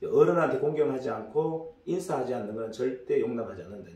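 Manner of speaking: ordinary talk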